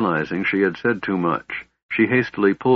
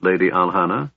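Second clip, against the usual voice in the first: ordinary delivery, nothing marked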